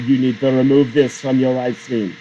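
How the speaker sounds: put-on stern Russian accent lawyer. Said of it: "why they had a Russian accent".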